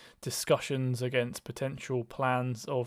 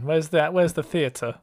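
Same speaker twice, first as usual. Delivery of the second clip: playful exasperation; 4 semitones higher, 8 dB louder than usual. Real, not acted. Host demanding theatrics, playful.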